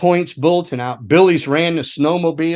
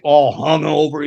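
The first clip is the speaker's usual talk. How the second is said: plain reading voice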